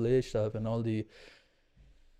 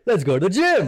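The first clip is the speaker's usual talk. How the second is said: mock eager; 6 semitones higher, 8 dB louder than usual. Mock cheerful wake-up.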